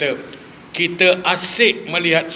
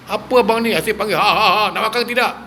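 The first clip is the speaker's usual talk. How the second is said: mock angry wife; 6 semitones higher, faster than usual. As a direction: play the nagging wife losing her temper, half joking.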